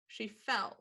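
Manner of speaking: ordinary talk, nothing marked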